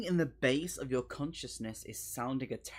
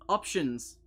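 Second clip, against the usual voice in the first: ordinary delivery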